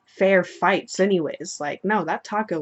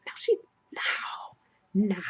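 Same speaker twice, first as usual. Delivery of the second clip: put-on slow, whispery kid denial; drawn-out hushed no from a small boy; little brother voice; 2 semitones higher, much slower than usual. Brother contradicting his little sister, whispery drawn-out child voice. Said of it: "the brother's just like".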